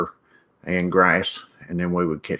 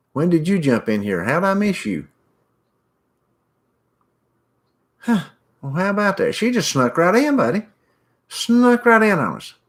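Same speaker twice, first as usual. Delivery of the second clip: much higher, sing-song teasing; playful teasing; 10 semitones higher than usual. Real, not acted. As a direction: tease her for sneaking in unseen. Teasing a viewer who joined quietly.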